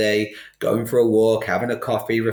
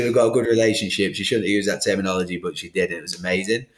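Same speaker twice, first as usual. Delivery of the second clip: normal talking voice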